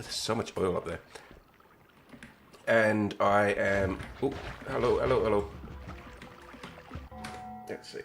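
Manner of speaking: ordinary talk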